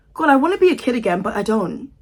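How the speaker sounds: ordinary delivery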